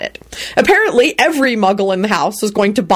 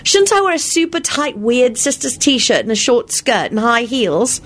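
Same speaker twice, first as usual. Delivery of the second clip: put-on girlish voice; 7 semitones higher than usual. A narrator's reading. Girl character voiced by narrator.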